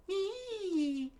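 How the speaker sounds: very high, drawn-out eee; frightened squeal. A narrator's reading. Nami and Usopp playing dead, frightened squeal. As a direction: hold a thin falsetto squeal.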